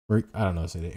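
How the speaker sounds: usual speaking voice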